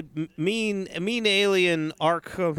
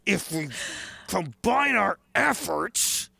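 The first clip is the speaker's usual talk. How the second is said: through gritted teeth; 2 semitones higher than usual. Retold or imitated. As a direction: squeeze it out past clenched teeth. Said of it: "literally through gritted teeth".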